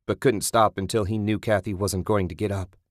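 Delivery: plain reading voice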